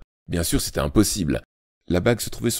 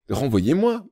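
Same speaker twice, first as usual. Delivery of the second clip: high bold retort; a boy's daring challenge; defiant retort; 9 semitones higher, slower than usual. A narrator's reading. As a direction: throw it back at him. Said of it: "rétorqua David".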